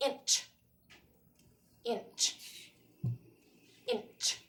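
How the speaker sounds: "Inch" ending in a quick puff of "ch"; teacher repeats "Inch" with a crisp final ch. Shown as a demonstration. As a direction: clip the "ch" of "Inch" short. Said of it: "A quick puff or a long puff".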